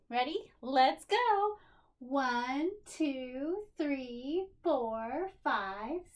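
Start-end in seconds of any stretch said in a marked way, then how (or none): 0.6-1.6 excited. Real, not acted. Kicking off counting.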